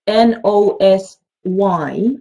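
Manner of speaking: spelled letter by letter, slowly; spelling out. Shown as a demonstration. Teacher spelling out a new word slowly for students. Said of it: "это слово nosy".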